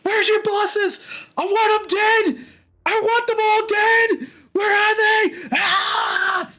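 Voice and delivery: high, loud, raging yells; screaming in fury. Acted. GM voicing Rex screaming at the captive Dragonborn. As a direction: bellow demands like a madman. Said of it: "You can now hear him screaming".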